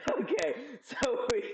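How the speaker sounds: words squeezed out through real laughter; laughing. Real, not acted. Streamer laughing while narrating a friend's cooking mess, real laughter. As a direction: try to talk but can't stop laughing. Laughing at husband's kitchen mishap.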